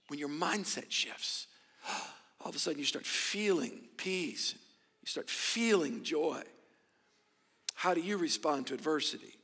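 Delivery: low, soft, tender; soft preaching. Acted. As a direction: drop it soft and gentle.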